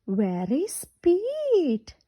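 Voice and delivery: ordinary delivery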